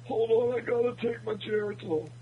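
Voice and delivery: put-on high, creaky old-timer; like a grandpa reaching for his pills; old man voice. Old man needs his Geritol.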